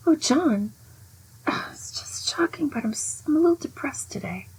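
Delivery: high, breathy whispered voice of a cold, mopey mother; mother's weary lament. Retold or imitated. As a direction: sigh it out like a mother who is always put-upon.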